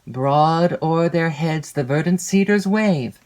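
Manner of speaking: ordinary delivery, nothing marked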